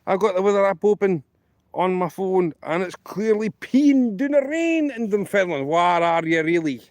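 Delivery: mock accusing. Joke caller confronts the bank lass about her lie, mock indignant.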